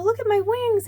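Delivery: put-on high, bright play voice for a little girl; delighted squeal of make-believe; playful fairy voice. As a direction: play the fairy showing off her wings. Playing fairies with a five-year-old.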